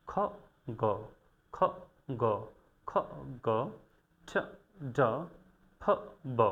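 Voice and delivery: খ, গ, ঠ, ড, ফ, ব each said alone; phoneme recap. Shown as a demonstration. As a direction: review drill of খ, গ, ঠ, ড, ফ, ব. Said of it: "এই দুইটা ফনেমস".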